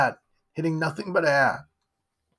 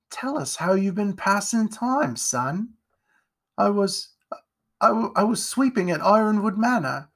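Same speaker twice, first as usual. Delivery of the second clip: higher, hesitant lad's voice; young Jack; 8 semitones higher than usual. Acted. Jack stammering to his mother, higher lad voice. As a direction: stammer it like a nervous boy.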